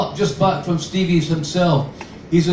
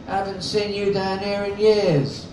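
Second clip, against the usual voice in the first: a touch of a whiny London junkie voice, pitched up; lightly voiced wheedling London girl, quieter; nasal whine; 4 semitones higher, 3 dB quieter than usual. A narrator's reading. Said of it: "in the archetypal nasal whine".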